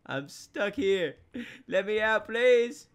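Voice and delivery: high, loud begging; pleading to escape. Real, not acted. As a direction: call out for help, rising in urgency. Trapped in game, begs.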